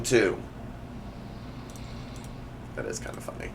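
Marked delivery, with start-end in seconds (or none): 2.7-3.5 hushed whisper, almost no voice; a barely voiced whispered aside. Real, not acted. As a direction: breathe it under your breath. Aside after reading text.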